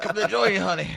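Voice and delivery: put-on high, loud old man clutching his chest; Fred Sanford impression. Retelling his fainting to his co-host, a loud, high mock Fred Sanford. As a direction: ham up a dying old man calling to his late wife. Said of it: "looked like Fred Sanford from Sanford and Son".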